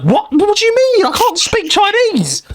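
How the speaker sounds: high-pitched, drawn-out comic outrage; exasperated, voice shooting way up; mock exasperation. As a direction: wail it in baffled mock despair. Exasperated reply to scam robot, acted.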